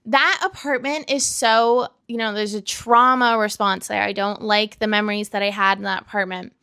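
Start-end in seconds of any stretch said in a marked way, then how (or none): none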